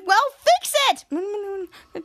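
high, drawn-out mock order; acted demand. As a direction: stretch it out high, in a put-on voice. Acts daughter's demand to dad.

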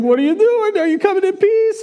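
hammed-up panic from the city; scared townspeople meeting Samuel, high and panicky; mock terrified townsfolk. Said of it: "the city's all terrified".

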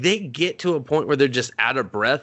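ordinary talk, nothing marked

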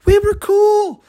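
high, whiny mock innocence; mock hurt confusion. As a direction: play it wounded and baffled. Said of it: "I'm like".

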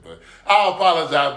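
normal talking voice

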